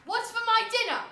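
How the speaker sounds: loud, high demand from the father; angry shout. Acted. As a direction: shout for your dinner. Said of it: "banged his fist on the table and shouted".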